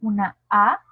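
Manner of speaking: «una A» con la A más fuerte y abierta; demostrando la A. Shown as a demonstration. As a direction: marca la vocal «A» clara y sonora. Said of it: "no es lo mismo hacer". (la «A» de «una A» bien sostenida y fuerte)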